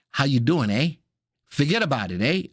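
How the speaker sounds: put-on lightly mixed Brooklyn and Canadian accent. Host jokes at viewer from both places, mock Canadian-Brooklyn accent. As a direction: play a Brooklyn local with a Canadian "eh". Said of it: "How do you talk Canadian Brooklyn?".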